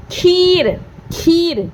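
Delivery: "खीर" with a drawn-out ee; "खीर" said alone, vowel held long. Shown as a demonstration. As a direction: stretch the ee in "खीर" for learners. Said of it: "ई की sound बड़ी आ रही".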